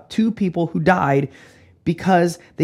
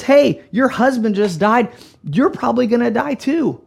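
rough confrontation; 6 semitones higher, 4 dB louder than usual. Acted. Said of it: "that is some rough stuff".

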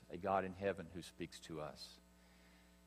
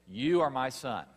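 louder, higher, commanding pronouncement; voice from heaven; 4 semitones higher, 7 dB louder than usual. Acted. Preacher repeating God's word over Jesus, loud and commanding. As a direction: declare it with heaven's authority. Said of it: "The voice from heaven says".

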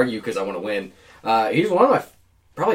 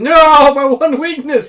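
mock anguish, much higher and louder; hammed-up villain wail; 14 semitones higher, 12 dB louder, much slower than usual. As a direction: cry out like a villain struck down.

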